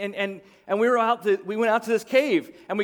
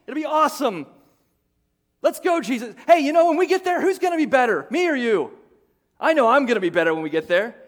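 cocky disciple, higher and louder, bragging; boastful disciples; 6 semitones higher, 5 dB louder than usual. Pretend. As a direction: brag that you'll beat your friend. Disciples boast who is greatest.

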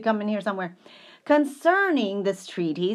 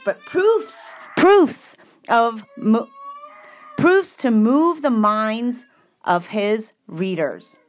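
loud, slow, building emphasis; emphatic; 3 semitones higher, 11 dB louder, slower than usual. Acted. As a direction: hammer the word proofs.

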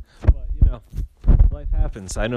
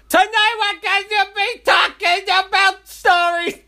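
much higher, hyped announcement; hammed-up announcer; 6 dB louder than usual. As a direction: sell the topic like a game show host. Host announces topic.

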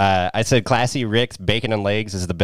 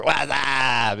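drawn-out comic catchphrase, a little higher; imitated catchphrase; 5 semitones higher than usual. Podcast host mimics every character in the movie, drawn-out catchphrase. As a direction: stretch it out like a movie gag. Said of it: "it just cuts to, like, every character".

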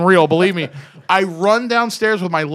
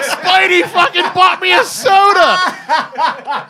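slow, much higher, loud laughing shout; delighted shout; 14 semitones higher, 8 dB louder, much slower than usual. Retold or imitated. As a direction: draw it out in giddy disbelief, laughing. Retold fan outburst.

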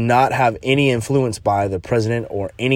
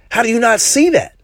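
much higher, louder indignant question; exasperated disbelief; 12 semitones higher, 4 dB louder than usual. Real, not acted. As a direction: demand it in disbelief. Indignant rant about racist cops.

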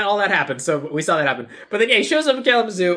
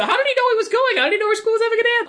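incredulous; 13 semitones higher, faster than usual. Real, not acted. Incredulous about the plot.

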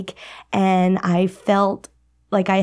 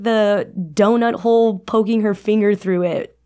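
ordinary talk

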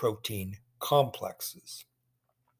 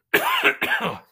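one sharp chesty cough; 6 dB louder than usual. Real, not acted. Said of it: "Sorry for the coughing".

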